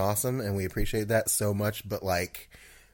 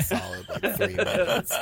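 laughing through the words; words breaking into laughter; 10 semitones higher than usual. Real, not acted. Laughing while telling.

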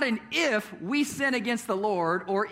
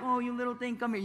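put-on soft, higher cooing to a baby; soothing dad; 3 semitones higher, 6 dB quieter, faster than usual. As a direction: mimic a tender parent comforting a toddler. Dad comforting crying baby.

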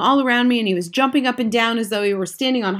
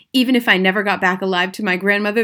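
plain reading voice

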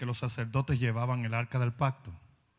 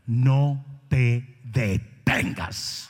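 slow, louder, drawn-out charge; solemn command; 3 semitones lower, 6 dB louder, much slower than usual. Acted. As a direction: lay each word down slowly and heavily. Sermon climax exhortation.

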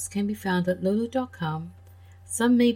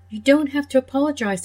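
higher and louder, kindly insisting; dog gently brushing off the apology; reassuring dog; 6 semitones higher, 6 dB louder than usual. Acted. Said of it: "said the dog".

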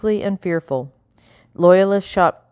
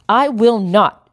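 loud, higher, slow and defiant; shouted refusal; 5 semitones higher, 5 dB louder, slower than usual. A narrator's reading. Madame shouting defiance at her fleeing husband, slow and loud. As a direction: plant your feet and shout it. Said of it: "she shouted".

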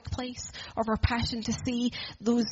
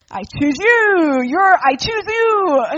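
put-on loud, slow, higher kid captain calling his pick; eager team captain; 7 semitones higher, 13 dB louder, slower than usual. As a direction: shout the pick across the playground, slow and proud. Team captain picking kids.

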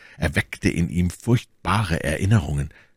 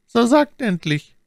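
lightly acted lady, high-pitched and louder; dull-voiced Milady; 5 dB louder than usual. A narrator's reading. Milady demanding that her visitor speak, dull high voice. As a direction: press for an answer in a high, dulled character voice. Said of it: "mit dumpfer Stimme".

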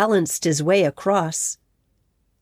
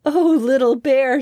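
higher and louder delighted "oh"; a mother moved by a gift; delighted gasp of a mother; touched surprise; 6 semitones higher, 4 dB louder than usual. A narrator's reading. Mother sees flowers from cub.

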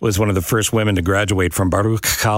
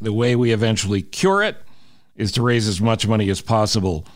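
ordinary talk, nothing marked

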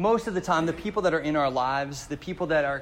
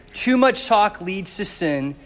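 slow scripture reading; 5 dB louder, slower than usual. Acted. Scripture read slowly.

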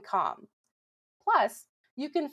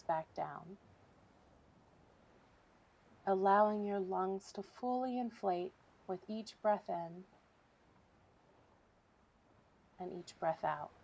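softer, unhurried relaxation voice with long pauses; guided meditation; 8 dB quieter than usual. Real, not acted. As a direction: guide listeners gently through breathing, leaving room between phrases. Leads a breathing exercise.

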